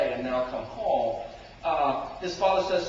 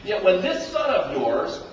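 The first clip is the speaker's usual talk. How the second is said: loud with a laugh in it; preacher resuming after a joke; 3 semitones higher, 7 dB louder than usual. Acted. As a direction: break into laughter. Laughing back into the quote.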